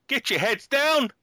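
high, loud, drawn-out mock golf yell; yelled warning. Golfer joking to co-host about warnings, high loud yell. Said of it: "and yell".

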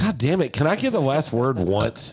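mock frustration.

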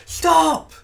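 high, loud yell of stop; shouted command. Acted. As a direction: bark it down at them. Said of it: "just go".

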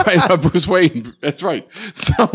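ordinary talk

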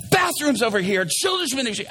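high, loud mock-panicked notices; frantic announcements. Pastor dodging a gunman during announcements, frantic. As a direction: call out notices in comic panic. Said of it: "I'm up there and I'm moving really fast".

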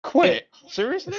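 high, disbelieving cry; can't believe what he just heard; incredulous. Real, not acted. Host shocked at co-host's answer.

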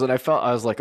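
normal talking voice

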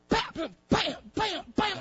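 imitated bangs. Mimics the child's smacking noises.

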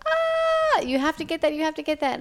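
high, urgent mock scream. Hunter begging the guide to shoot the coyote, high urgent yell. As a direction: beg the guide, panicked and high. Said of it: "I screamed".

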